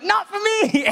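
squeaky high "not for me"; a comic high-pitched no thanks; hammed-up high refusal; high mock refusal. Ditching the deliverance scene.

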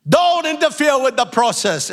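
usual speaking voice

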